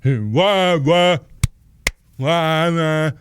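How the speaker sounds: high, loud, repeated wahs; loud wah-wah noises. Real, not acted. Streamer testing laggy audio for viewers, loud wah-wahs. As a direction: blare out wah after wah.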